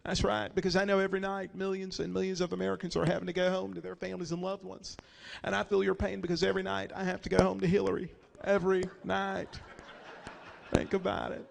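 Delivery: low, soft, empathetic president; Bill Clinton. Acted. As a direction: connect with the listener, hushed and sympathetic. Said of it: "Bill's like".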